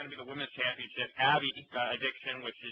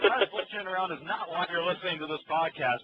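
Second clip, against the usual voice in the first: normal talking voice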